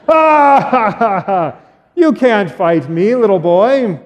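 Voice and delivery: loud ha ha into a slow taunt; hammed-up giant sneer; mocking giant. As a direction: laugh down at the little boy. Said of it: "starts to laugh".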